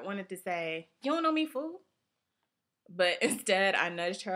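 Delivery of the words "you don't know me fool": high, loud mock-gangster challenge. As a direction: throw it out like a friend itching for a fight. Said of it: "on the verge of going gangster".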